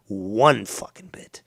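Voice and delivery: each word hammered slowly; slow, weighty emphasis; slow emphatic repeat. Real, not acted.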